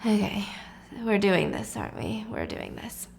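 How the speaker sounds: low, fading mutter; quiet resignation. A narrator's reading. Husband gives in to wife's demand, resigned.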